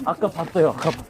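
put-on loud drunken refusal; pretend drunk. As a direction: wave them off, loud and drunk. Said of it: "술 취한 척하고".

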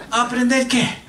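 mock-exasperated chuckle; laughing in disbelief. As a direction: laugh it off like it's absurd. Incredulous laugh at Cuba trip.

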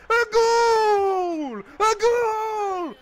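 drawn-out high goal yell; long goal yell. Real, not acted. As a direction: stretch out the goal call, loud. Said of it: "finna have a heart attack yelling "goal".

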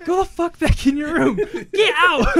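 high, loud comic shout; yelling. Retold or imitated. Retelling how he chased his brother off, yelling. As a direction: yell it high as if banishing someone.

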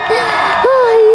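ordinary talk, nothing marked

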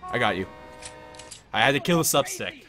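loud, hammed-up patriotic cop; 1930s beat cop. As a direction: preach it like a proud lawman. Mock patriotic cop speech.

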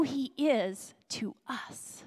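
whispered, slow and intimate. Real, not acted. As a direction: lean in and whisper it. Preacher drops to a whisper.

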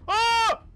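startled scream. Real, not acted. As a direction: jerk back screaming in fright. Sudden scare in horror game.

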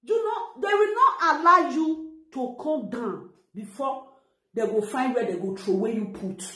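ordinary talk

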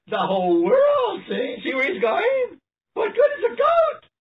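high-pitched, incredulous outburst; excited exclamation. Real, not acted. Preacher asks listeners what good a goat is, excited exclamation. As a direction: shoot the pitch right up, exclaiming.

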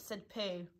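ordinary delivery

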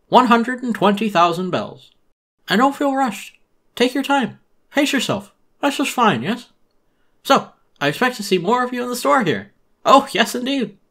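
high, loud, oily shopkeeper pitch; high, loud salesman voice full of fake cheer; Tom Nook voice. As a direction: sell it like a pushy merchant greeting a customer.

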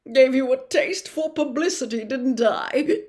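a vain celebrity professor, loud and chummy; booming, self-satisfied charm; Lockhart voice. Acted.

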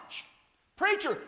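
ordinary talk

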